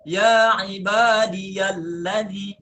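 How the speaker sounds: ya ibadiyalladzi stretched out slowly with the ya kept; slow recitation. Shown as a demonstration. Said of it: "sebagian qurra ini membaca". (ya ibadiyalladzi dibaca panjang)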